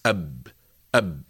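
low "أب", closed on the b, the reverse of "ba"; consonant with sukoon. Shown as a demonstration. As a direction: shut "أب" on a vowelless b. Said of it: "عكس". (نطق "أب" منخفضة بباء ساكنة)

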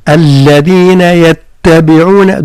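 الذين يتبعون slow, doubled letters held; shadda stressed. Shown as a demonstration. Teacher showing the shadda without exaggeration. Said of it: "دون مبالغة بطبيعة الحال".

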